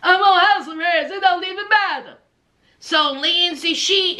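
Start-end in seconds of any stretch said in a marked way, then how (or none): 0.0-2.2 lower, fast offhand man; Blaine casual. Retold or imitated. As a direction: shrug it off, lower and quick. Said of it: "Blaine said".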